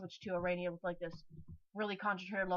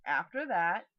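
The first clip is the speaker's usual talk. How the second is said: burp while speaking; a burp in the words; 5 dB louder, slower than usual. Real, not acted. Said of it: "I'm burping again".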